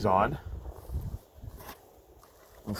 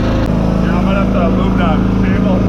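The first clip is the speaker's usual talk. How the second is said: yelling over the machine; loud, higher talk over the roar; shouting; 7 semitones higher, 16 dB louder than usual. Real, not acted. Talking beside the running processor.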